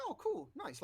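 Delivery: high, pleased mock reaction; delighted. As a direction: chirp it out cheerfully.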